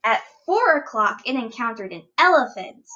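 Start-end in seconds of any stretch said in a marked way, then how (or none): none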